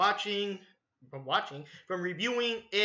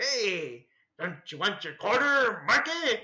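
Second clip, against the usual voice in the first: Pennywise; 2 semitones higher, 3 dB louder, slower than usual. Retold or imitated. Clown offers the lost quarter, creepy coaxing. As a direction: tempt him like a sinister clown. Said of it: "I'm Pennywise the Dancing Clown".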